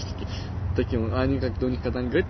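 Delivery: ordinary delivery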